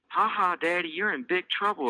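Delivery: put-on high teasing child voice; gloating girl. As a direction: gloat at daddy playfully. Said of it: "Elise gloats".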